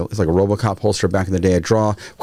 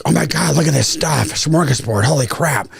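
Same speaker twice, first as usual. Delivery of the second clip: higher-pitched excited gawking, retold; imitated amazement; 6 semitones higher than usual. Visitors pop out and see the gun table, imitated amazement. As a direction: mimic visitors marveling at the spread.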